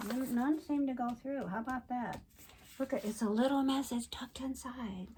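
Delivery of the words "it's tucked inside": soft whispered aside. Real, not acted. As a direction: whisper it like a secret. Secretive aside about the card.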